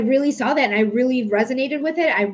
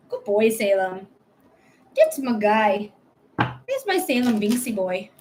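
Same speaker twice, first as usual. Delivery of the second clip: pet praise; 3 semitones lower, slower than usual. Real, not acted. Praising the cat.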